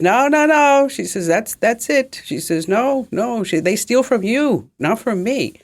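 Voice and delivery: put-on dry cleaner refusing, in her Vietnamese accent. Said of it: "she's from Vietnam".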